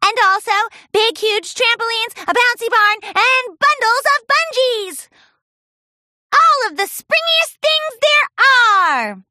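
high, loud gushing list; excited listing. Acted. Pinkie boasting to fillies, high excited list. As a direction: build up to the biggest.

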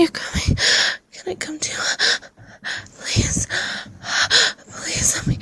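urgent breathy pleading; breathless begging. Acted. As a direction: beg between breaths.

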